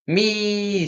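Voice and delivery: shrieking bird voice; owl screech. A narrator's reading. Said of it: "screeched the owl again".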